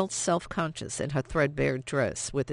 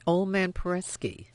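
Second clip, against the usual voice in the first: ordinary delivery, nothing marked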